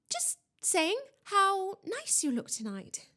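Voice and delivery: high, slow, over-sweet cover-up; forced cheer. A narrator's reading. Student covers up to the ghost, falsely cheerful. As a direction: stretch out the false compliment brightly.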